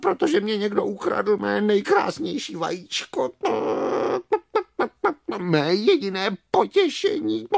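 tearful, clucking hen wail; crying hen. Acted. Hen whose most beautiful egg was stolen, crying. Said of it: "plakala slepice".

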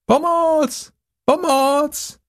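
very high distant cry for help; a small voice shouting from a treetop; calling for help. A narrator's reading. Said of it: "Volají pomoc". (volání o pomoc z vysokého stromu)

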